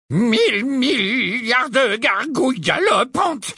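slow, high, spluttering exclamation; Professor Tufty. A narrator's reading. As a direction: stutter out a shocked old-man oath. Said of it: "s'écria le professeur Tufty".

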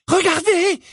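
high, urgent cry; calling out. A narrator's reading. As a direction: call everyone to look, high. Pupil points out the castle.

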